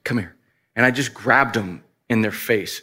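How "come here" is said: close-up fatherly address. Retold or imitated. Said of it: "got down close to my boys".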